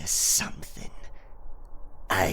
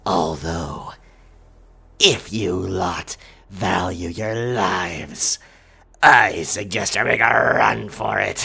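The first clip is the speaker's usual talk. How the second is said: growing louder into a menacing warning; menacing threat; 7 dB louder than usual. Acted. Pirate warns his captives to flee, rising menace. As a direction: turn the whisper into a rising threat.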